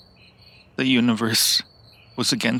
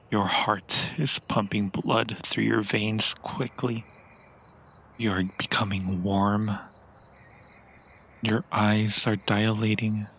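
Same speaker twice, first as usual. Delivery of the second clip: normal talking voice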